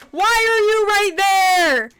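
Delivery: high, drawn-out shriek of fright; startled scream. Real, not acted. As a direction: scream as if caught off guard. Jump scare from animatronic.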